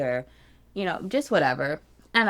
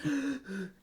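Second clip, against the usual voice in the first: soft gasp; 8 semitones higher, 8 dB quieter than usual. Real, not acted. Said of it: "I'm like struggling".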